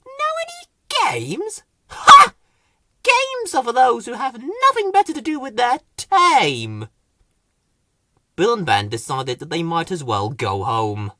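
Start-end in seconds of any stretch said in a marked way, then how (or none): 0.0-6.9 much higher haughty scorn with a loud laugh; high scornful laugh and disdain; scornful Rose. Acted. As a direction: sneer at the idea. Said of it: "Rose laughed".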